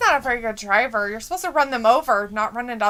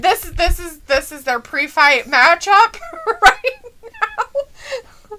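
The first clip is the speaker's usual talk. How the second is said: slow, higher laughing delivery; real laugh at the segment; 7 semitones higher, much slower than usual. Real, not acted. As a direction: drag it out through laughter. Laughing at the show.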